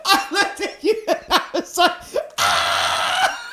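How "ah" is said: a short laughing "Ah!" burst out; a surprised yelp through laughter; mock startled cry. Friend recalls the moment in a laughing yelp.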